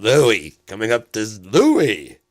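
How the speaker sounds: loud, drawn-out mock introduction; flustered stage announcer. Host dodging a hard surname, loud announcer call. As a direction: boom out the first name instead.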